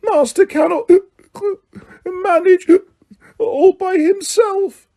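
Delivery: high, slow whimpering elf voice; Winky whimpering about her master's need. A narrator's reading. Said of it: "whimpered the elf".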